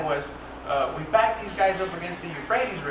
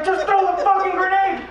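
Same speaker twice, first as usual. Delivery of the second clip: high, louder fed-up command; mock exasperated order; 10 semitones higher, 3 dB louder than usual. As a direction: bark the order, hammed up. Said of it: "he was like".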